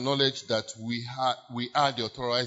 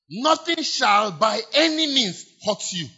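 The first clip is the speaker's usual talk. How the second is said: slow, high, loud ringing cry; proclaiming; 8 semitones higher, 6 dB louder than usual. Acted. Preacher proclaiming the promise to the church, loud and slow. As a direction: hold the words out in triumph.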